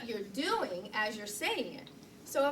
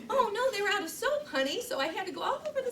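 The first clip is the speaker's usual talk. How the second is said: higher and louder, a fake-innocent fib through a laugh; mock innocent excuse; 9 semitones higher, 5 dB louder than usual. Fibbing to her husband about the long shopping trip, mock innocent and laughing. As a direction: sell the harmless excuse with a giggle.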